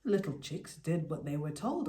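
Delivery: ordinary delivery, nothing marked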